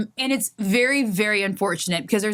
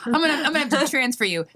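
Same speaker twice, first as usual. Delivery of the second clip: fast, bored agent brush-off; quick mock call-center brush-off; mock rushed agent; 4 semitones higher, much faster than usual.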